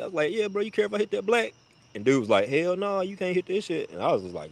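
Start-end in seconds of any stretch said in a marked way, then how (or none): 0.3-1.5 quick and pitched way up, asking nicely; high, fast mock-innocent request; high polite self-imitation. 2.5-3.9 mock dude refusal.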